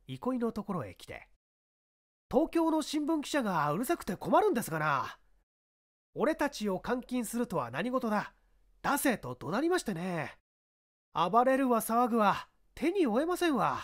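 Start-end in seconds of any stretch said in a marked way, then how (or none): 2.3-13.8 higher, exasperated constable complaining; flustered policeman. Acted. Policeman complains to the inspector, harried and high. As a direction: grumble about the unruly reporter.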